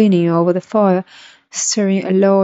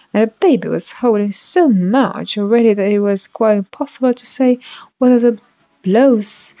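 ordinary delivery, nothing marked

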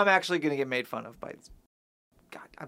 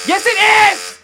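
high, loud outraged yell; outraged shout; 14 semitones higher, 10 dB louder, slower than usual. Real, not acted. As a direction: blurt it out at full volume. Furious outburst over disputed answer.

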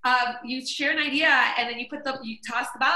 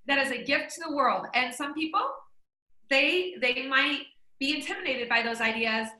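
normal talking voice

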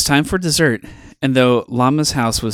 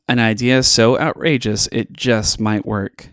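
usual speaking voice

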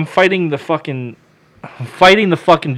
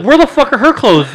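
high, loud indignant demand; retold outrage; 10 semitones higher, 5 dB louder than usual. Demanding Keith explain the missing clothes, retold outrage. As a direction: indignantly demand an answer, acted out.

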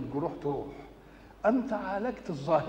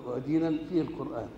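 ordinary talk, nothing marked

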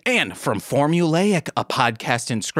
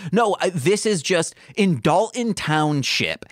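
ordinary delivery

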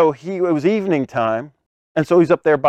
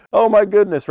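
raised mock-alarmed exclamation; freaking out; 6 semitones higher, 3 dB louder than usual. Preacher mimics disciples in a Scooby-Doo scare, freaking out. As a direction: play up cartoonish panic a little. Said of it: "So they're freaking out".

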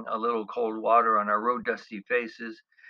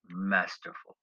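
normal talking voice

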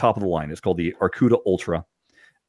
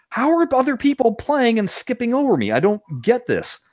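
high-pitched exasperated complaint; frustrated. Retold or imitated. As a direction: act out baffled frustration, pitched way up. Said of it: "Yeah, that's frustrating".

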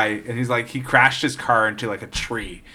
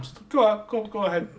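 mock embarrassed urging; 9 semitones higher, 4 dB quieter than usual. Imagined waving elevator passengers onward.

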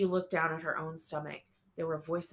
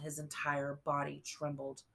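usual speaking voice

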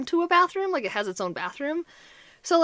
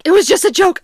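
high, loud pleading excuse; backpedaling; 8 semitones higher, 13 dB louder than usual. Retold or imitated. Joker backpedals to angry wife, retold loud pleading. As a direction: protest innocence in a hurry, voice up.

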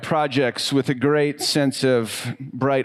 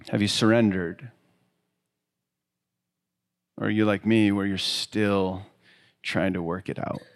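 reflective sermon invitation; 3 semitones lower than usual. Acted. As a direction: gently invite the listeners to look inward.

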